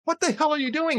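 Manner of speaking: the nervous passenger finally cracks; alarmed passenger. Acted. Panicked passenger in a joke.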